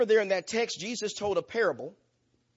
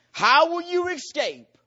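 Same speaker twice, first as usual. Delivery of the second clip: much higher, louder and slow; thundering rebuke; 9 semitones higher, 7 dB louder, slower than usual. Acted. Jesus confronting the Pharisees, a slow, high, thundering rebuke. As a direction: hurl the question at them.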